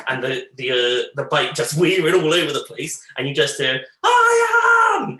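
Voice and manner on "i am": very high, loud, drawn-out wail; brother's drawn-out wail down the hill; slow panicked cry. Retold or imitated. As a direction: stretch the cry out slowly as if fading away. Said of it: "in a really slowly".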